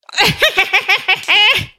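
high, shrieking witch cackle. Pretend. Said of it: "let me do my best witch cackle".

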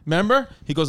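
ordinary delivery

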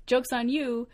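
playful victory shout, not too serious; mock triumphant retort. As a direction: crow at them, half joking. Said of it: "And I was like".

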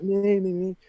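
high, pleading volunteer squeal; imitated eager kid. Boy volunteering to the clowns, imitated eagerness.

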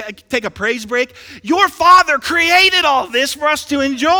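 high, loud, exultant preacher; fervent preaching. Real, not acted. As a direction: call the church to praise with full force. Preaching at full force.

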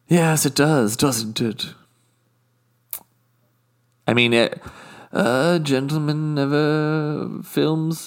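haughty British guest, smug and unhurried; a snooty Brit savouring every indiscretion; posh British accent. Acted. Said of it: "my British accent slips".

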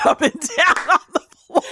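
high, helpless laugh through the words. Real, not acted. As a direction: voice rising into laughter. Cracking up recounting the scene.

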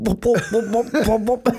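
high-pitched bobbing gulps; imitated bops of a kid bobbling under; bobbling in water. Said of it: "in there bobbling in the water going".

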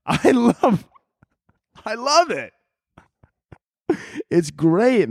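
high-pitched, breaking up with laughter; laughing. Real, not acted. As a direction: squeeze the words out through real laughter. Cracking up at own bit.

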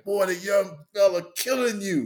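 high, hammed-up sideline needle; Paul Silas taunt. Paul Silas mocking the player Mason is beating, high taunt. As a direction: rib him like a coach heckling from the bench. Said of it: "He was like".

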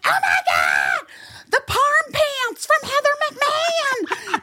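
very high, drawn-out mock scream; imitated fan screech. Fan yelling from her car at merch pants, mock screaming. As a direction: screech it like a starstruck fan, no real volume. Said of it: "but screaming, you know".

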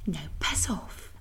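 slow, breathless whisper; mock whisper with a slow finish; whispered kiss-off. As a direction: hiss the dismissal as a sly aside. End of a mock Mexican quote.

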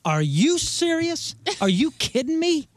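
high, drawn-out mock outrage at a guest; mock disbelief. Local playfully scandalized at a visitor's taste. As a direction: pitch it up, scandalized that they dislike it.

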